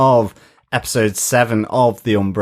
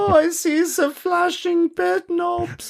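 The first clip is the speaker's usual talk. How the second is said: high, slow, falsetto comic catchphrase; hammed-up sitcom line, squeaky and drawn out; 'Allo 'Allo catchphrase. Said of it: "we all watched Hello Hello".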